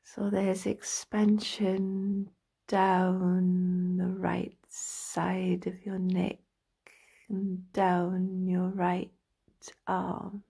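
slow meditation voice with drawn-out words and long pauses; guided relaxation. Acted. Practitioner talking a listener into lying down and stretching, slow guided meditation. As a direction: guide them through the body slowly, stretching each phrase.

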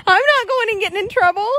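high, playful dog voice; voicing the dog. Voicing the dog Bear refusing to come, high and playful. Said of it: "Bear is like".

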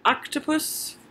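ordinary delivery, nothing marked

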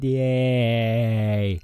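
long, held-out yay; drawn-out cheer. Pretend. YouTuber welcoming viewers back to the game, drawn-out cheer.

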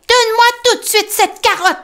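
high, loud commanding mole; Tom ordering. Acted. Tom to rabbit fifty-four, high loud order. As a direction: demand the carrot back. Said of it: "ordonne-t-il".

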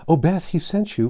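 high, loud and bursting with news; eager outburst. Acted. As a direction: blurt it out, too excited to wait. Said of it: "gesticulating with unseemly energy".

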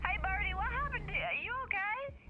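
high, worried check-in; startled, high-voiced concern; alarmed concern. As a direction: pitch it way up, fussing over a friend. Alarmed check on ailing friend.

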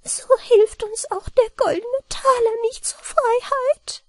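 very high little-man voice, downcast; a tiny man giving up hope; high sorrowful gnome voice; sorrowful mannikin. A narrator's reading. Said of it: "sagte betrübt".

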